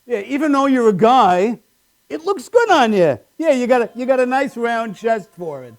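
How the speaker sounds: high, mildly cheery backhanded compliment; a hint of a chummy manager; lightly voiced coworker. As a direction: lightly mimic the boss's fake sincerity. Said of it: "I was like".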